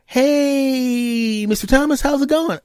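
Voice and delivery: high, bright-toned imitation of the doctor's greeting; cheery doctor voice. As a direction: chirp it like a friendly doctor calling. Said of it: "She goes".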